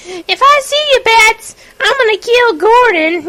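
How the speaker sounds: a playful high-pitched threat as Harley; Harley Quinn voice. Retold or imitated. Harley Quinn threatens Batman in game.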